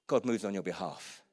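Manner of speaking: usual speaking voice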